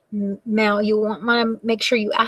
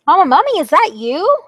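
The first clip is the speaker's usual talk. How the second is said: put-on higher, louder little child call; 8 semitones higher, 7 dB louder than usual. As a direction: call out like a lost little kid. Baby mummy calls out.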